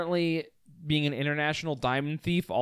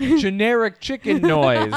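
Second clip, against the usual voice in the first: mock deadpan; 7 semitones higher, 6 dB louder, slower than usual. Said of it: "he said the words".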